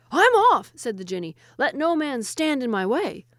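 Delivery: very high, loud, frantic exit; fleeing jinni. Acted. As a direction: shout it as you bolt. Jinni flees.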